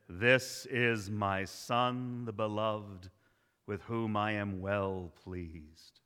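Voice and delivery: low, slow, solemn voice from heaven; a hint of a grave divine voice; voice of God. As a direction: intone it as God speaking. Reading God's voice from heaven.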